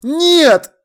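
loud, high denial; drawn, desperate yell; shouting. A narrator's reading. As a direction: cry out in protest. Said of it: "закричал я".

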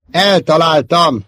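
loud, high, drawn-out triumphant cry; exclaiming. A narrator's reading. Pilot announcing he hit the target, exclaiming. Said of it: "kiáltott föl".

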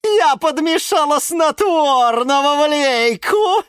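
high, loud, slow boast; villainous gloating. Acted. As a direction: crow over your wicked trick. Villain gloats over her trick.

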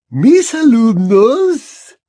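a lofty headmistress, unhurried; Madame Maxime voiced.

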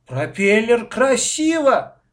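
loud, high, drawn-out protest; outraged child's shout; indignant. A narrator's reading. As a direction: insist indignantly, stretching the words. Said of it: "подпрыгнул от возмущения".

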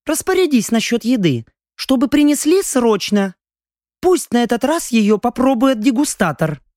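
high, composed countess; young lady voice. Acted. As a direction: give the order like a noblewoman. Young lady gives an order.

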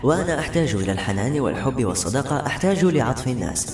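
ordinary delivery

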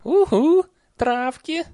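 very high, loud hummed "uh-huh"; hooting mumbled assent. A narrator's reading. Nanny answers about herbs while leaving, high hummed assent. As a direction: hum the agreement up high. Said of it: "промычала нянюшка Яг".

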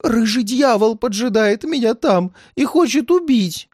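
put-on high, frightened old man; near tears. A narrator's reading. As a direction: plead in a high, fearful voice. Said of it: "чуть не заплакал он".

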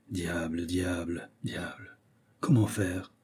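soft, low, fading "diable" muttered to himself; brooding quiet repetition. A narrator's reading. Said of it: "répéta La Fontaine".

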